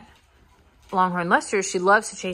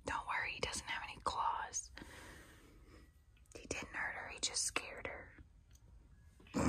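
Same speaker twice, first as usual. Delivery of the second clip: hushed aside to the camera; whisper; 21 dB quieter than usual. Real, not acted. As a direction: whisper it so the pets don't hear. Aside to viewers about the cat.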